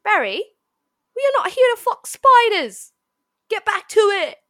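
loud, high Australian telling-off; hammed-up, shouted Australian accent; Aussie accent. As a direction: order a slacking mate back to work in Aussie. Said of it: "now the other guy responds".

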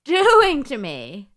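hammed-up anguished plea; desperate villager. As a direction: beg the wise man in despair. Voicing frantic man in the story.

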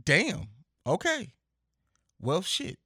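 high, stunned exclamations; real surprise after a powerful answer; stunned reaction. Real, not acted. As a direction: caught off guard, blurt it out high. Stunned by her strong answer.

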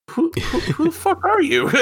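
very high, laughing disbelief; mock bewilderment, laughing. Fan retelling surprise at an unknown voice actor, laughing bewilderment. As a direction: stammer it out high, cracking up.

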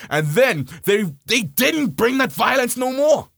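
normal talking voice

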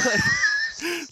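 very high shrieking cackle; shrieking laugh. Retold or imitated. Laughing shriek while retelling.

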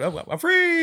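high, loud mock cry of freedom; gleeful escape. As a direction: whoop it like a dog on the loose. Acting out dog running off.

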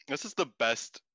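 ordinary delivery

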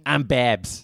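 thick Boston nurse voice, lightly done; a hint of a Boston drawl as the nurse; a thick Boston accent for the nurse. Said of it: "keep this accent up".

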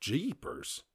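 "Jeepers" blurted high with a rising lift; genuinely surprised. Real, not acted. Podcaster reads that Britain quit coal, "Jeepers" in real surprise. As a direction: let "Jeepers" pop out in real astonishment. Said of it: "the word that pops out when I'm genuinely surprised".

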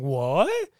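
shocked reaction. Retold or imitated. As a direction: act out a stunned double take. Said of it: "close-up of him going".

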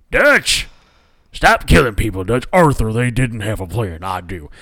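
lightly voiced western outlaws; a touch of a gruff cowboy plea; game quote. Arthur pleading with Dutch, lightly voiced outlaw. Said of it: "that's a reference to last week's episode".

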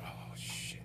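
breathy whispered dread; hushed "oh no" whisper. Retold or imitated. Dreading the call.

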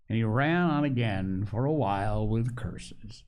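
ordinary delivery

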